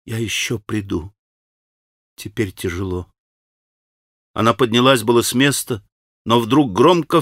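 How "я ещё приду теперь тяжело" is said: whispered. A narrator's reading. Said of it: "прошептала она".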